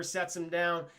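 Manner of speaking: ordinary delivery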